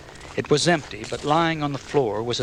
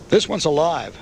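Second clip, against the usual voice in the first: raised, higher call; 6 semitones higher, 5 dB louder than usual. A narrator's reading. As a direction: call it across the lot. Jerry calls out finding survivor.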